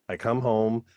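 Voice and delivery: ordinary delivery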